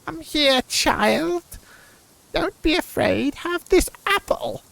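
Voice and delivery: put-on high, slow old crone voice; old witch voice. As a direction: croon it like a fairy-tale witch. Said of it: "I can't do an old female".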